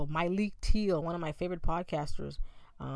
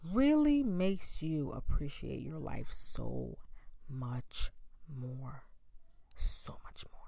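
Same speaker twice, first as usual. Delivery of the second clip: choked up; 2 semitones lower, slower than usual. Real, not acted. Emotional reflection on father's death.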